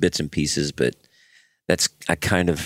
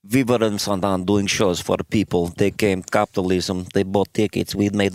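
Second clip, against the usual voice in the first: light mock Russian accent; hint of a Russian accent. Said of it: "I am learning how to do this voice".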